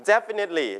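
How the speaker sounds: "Definitely" with the first syllable punched; stress on first syllable. Shown as a demonstration. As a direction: hit DEF in "Definitely", let the rest fall. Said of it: "พยางค์แรก". (เน้นพยางค์แรกของ "Definitely")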